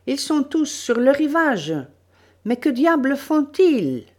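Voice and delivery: loud, high-pitched excited cry; sudden exclamation. Acted. Dario, running ahead, spots them on the shore, exclaiming. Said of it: "s'exclama tout à coup".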